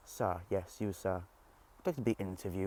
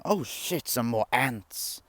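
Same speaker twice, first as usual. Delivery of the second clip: startled, higher louder exclamation; surprised; 3 semitones higher, 7 dB louder, much slower than usual. Real, not acted. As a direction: blurt it out in surprise. Suddenly spots ants.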